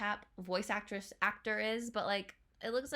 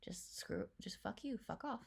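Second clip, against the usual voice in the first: hushed whispered cursing; whispering; 11 dB quieter than usual. Real, not acted. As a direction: whisper the curses under breath. Swearing under breath at screen.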